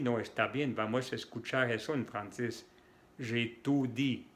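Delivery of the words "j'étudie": J'ÉTUDIE said slowly as j'ai tout dit; French ou sound. Shown as a demonstration. Teacher showing changed meaning, oo in the middle. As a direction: put a back oo in J'ÉTUDIE. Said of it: "He dicho todo".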